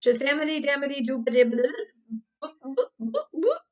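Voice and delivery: loud nonsense chant; mimicking tiktok tics. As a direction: rattle it off like a copied vocal tic. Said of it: "All the TikTok tickers kept saying".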